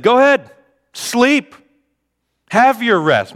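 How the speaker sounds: very high, loud sarcasm; sarcastic dismissal. Acted. Jesus waves off his sleeping disciples, very high and sarcastic. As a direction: throw the words at them, high and mocking.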